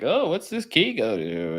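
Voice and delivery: high-pitched playful feigned puzzlement; mock wondering. Host imagining handing his family the last gift, mock wondering. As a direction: ask it high, as if clueless about the surprise. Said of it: "and be like".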